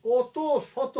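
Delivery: usual speaking voice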